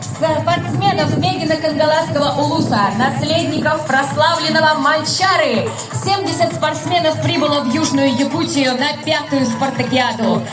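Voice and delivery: ordinary talk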